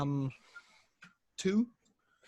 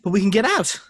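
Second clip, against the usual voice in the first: pleading; 10 dB louder than usual. Acted.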